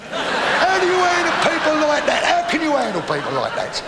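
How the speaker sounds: high, loud mock-outraged wail; exasperated outburst. Mock outrage at father-in-law.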